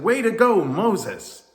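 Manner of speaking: high, loud jubilant cheer; excited cheer. A narrator's reading. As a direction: cheer wildly for your hero. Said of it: "cheered and all excitedly said".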